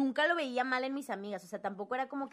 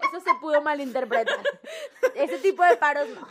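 laughing; 6 semitones higher, 13 dB louder than usual. Real, not acted. Embarrassed about a double meaning.

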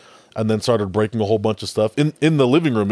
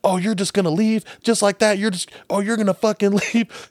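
high-pitched indignant wife, retold without real volume; mock yelling; 11 semitones higher than usual. Retelling a wife's row as her husband packs to leave, mock yell. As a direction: imitate an angry wife's high protest. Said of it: "she's yelling at him".

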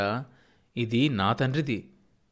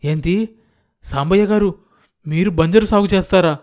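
higher, louder stunned question; shocked disbelief; 6 semitones higher, 8 dB louder than usual. A narrator's reading. Farmhand stunned to hear the landlord will farm, raised shocked voice. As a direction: blurt it out as if struck on the head. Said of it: "నెత్తిమీద తాటిపండు పడ్డట్లయింది".

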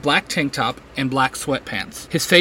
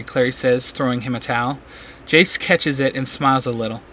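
ordinary delivery, nothing marked

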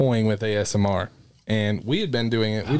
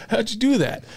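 surprised; 10 semitones higher than usual. Retold or imitated. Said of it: "And I was like".

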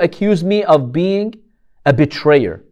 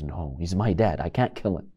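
much softer and lower, quiet anguished no; soft refusal; 11 semitones lower, 13 dB quieter than usual. Retold or imitated. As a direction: refuse gently but firmly. Son refusing to kill father.

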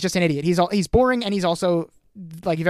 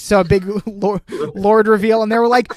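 normal talking voice

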